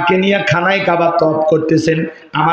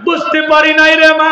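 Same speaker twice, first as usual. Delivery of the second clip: high, louder pleading cry; sermon wail; 10 semitones higher, 4 dB louder than usual. Acted. Son begging his dead mother's forgiveness, a high preached cry. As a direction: break into a high plea.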